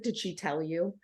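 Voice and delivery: husband nervously asking in a hushed voice; hushed worry. Retold or imitated.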